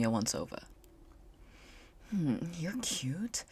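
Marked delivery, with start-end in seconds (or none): 2.1-3.4 flirty purr. Acted. Isabella flirts.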